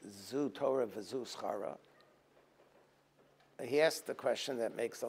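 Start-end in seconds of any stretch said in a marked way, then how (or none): 0.1-1.8 quiet, slow, a touch of grief; hushed grief. Retold or imitated. Moshe stunned at Akiva's fate, quiet and slow. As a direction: let it sink, barely above a breath.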